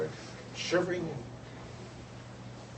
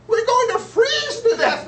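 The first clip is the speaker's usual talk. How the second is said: much higher and louder, slow wailing despair; despairing birds; 15 dB louder, slower than usual. Acted. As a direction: wail it like freezing birds.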